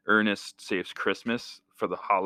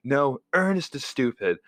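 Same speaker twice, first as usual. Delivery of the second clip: raised-pitch mock sibling comeback; bickering sister; 5 semitones higher than usual. Sister arguing with brother about his favorite, mimicked comeback. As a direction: fire back like a squabbling kid sister. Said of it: "And she's like".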